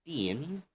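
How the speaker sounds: "been" with a long ee, high and stretched. Shown as a demonstration.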